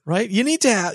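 usual speaking voice

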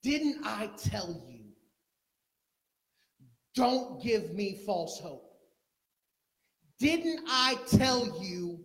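loud, slow, pleading rebuke; mother reproaching the prophet, slow and loud; slow anguished accusation. Acted. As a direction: drag out each word in hurt.

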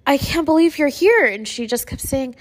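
high, strained voice close to tears; tearful, voice climbing with emotion; really teary. Real, not acted. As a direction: retell it while fighting back real tears. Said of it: "making me get teary-eyed".

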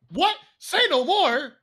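high, loud, indignant retort; outraged. Real, not acted. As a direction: fire it back in disbelief. Outraged reaction.

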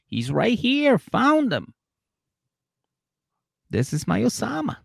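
high, excited squeal of discovery. Retold or imitated. DB proudly claims she found her Osama, high and gushing. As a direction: gush in a high-pitched mock voice. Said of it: "She's like".

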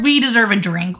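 normal talking voice

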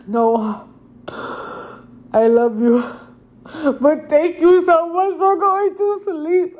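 put-on a high, tender hush to the sleeping baby, slow at the end; tender relief. Mother thanking her sleeping baby Noah, tender and high. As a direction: murmur love and thanks to a baby you daren't wake.

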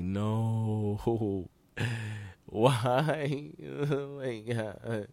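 drawn-out comic wail of dismay; hammed-up heartbroken lament; mock disappointment. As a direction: whine it slowly through laughter. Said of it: "I was so disappointed".

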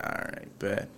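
breathy unvoiced all right bet; whispered resignation. Retelling giving up on prom date, whispered resignation. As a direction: let it out under your breath.

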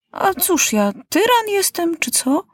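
put-on high, childish voice, half-joking question; young King Maciuś asking the doctor if he is a tyrant; boy king's voice. A narrator's reading. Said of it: "zapytał się Maciuś".

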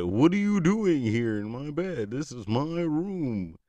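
high, loud animatronic voice with a drawn-out ending; animatronic character. Retold or imitated. Animatronic confronting man in bed.

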